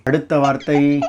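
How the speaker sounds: ordinary talk, nothing marked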